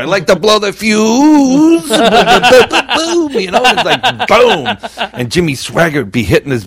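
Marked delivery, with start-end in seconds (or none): 0.0-1.9 high, slow, drawn-out camp voice; joke-character punchline. Acted. Condemned man in an old joke, camp put-on voice. 1.9-5.1 loud, high-pitched booms; laughing, punch out each boom high; excited imitated impact. Preacher hitting his Bible on the punchline, excited imitated booms.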